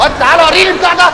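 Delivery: fast, high, loud shout. Acted. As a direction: yell the order quickly.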